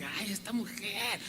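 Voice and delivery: soft grumble under the breath; incómodos. Retold or imitated. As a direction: mutter it quietly, put out by her. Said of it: "estaban incómodos, así como que". (quejido incómodo, en voz baja)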